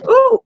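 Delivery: short, high, loud "ooh"; a sudden high exclamation; startled ooh. Real, not acted. Startled while adjusting the setup.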